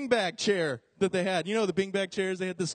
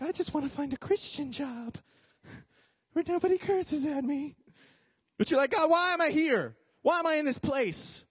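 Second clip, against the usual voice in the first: whiny believer; 8 semitones higher than usual. Retold or imitated.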